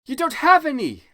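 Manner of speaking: high, loud furious cry; mad yell. Acted. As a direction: yell it madly. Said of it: "I yelled in madly".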